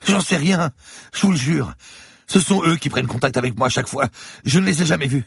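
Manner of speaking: a beaten lawyer gasping in fear; frightened bleeding man pleading with the cop; terrified captive. Acted. Lawyer swears ignorance.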